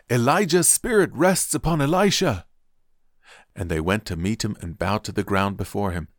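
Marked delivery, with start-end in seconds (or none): 0.1-2.5 high, loud exclamation; exclaiming. A narrator's reading. Prophets seeing the miracle from a distance, exclaiming. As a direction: proclaim it high and loud, as if amazed. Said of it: "they exclaimed".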